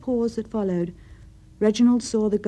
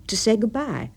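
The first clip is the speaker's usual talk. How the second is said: halting, raised-voice excuse; awkward, pressured delivery with a hitch; stammered out; 4 semitones higher, 6 dB louder than usual. A narrator's reading. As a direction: blurt it out, then falter before the end. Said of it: "stammered out".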